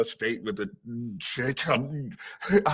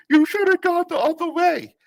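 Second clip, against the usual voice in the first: high put-on scolding coworker voice; snippy coworker; 5 dB louder than usual. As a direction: mimic her smug correction, pitched way up. Coworker's snippy remark imitated.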